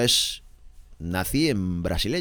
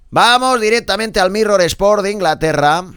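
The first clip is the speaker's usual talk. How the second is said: high, loud presenter's segue; projected, ringing sports-show introduction; announcer link; 9 semitones higher, 7 dB louder, slower than usual. Acted. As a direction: hail the next newspaper like a radio host. Announces the next newspaper.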